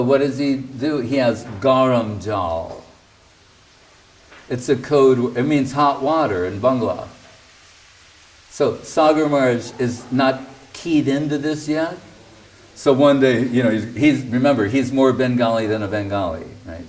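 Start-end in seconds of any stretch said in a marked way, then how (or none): none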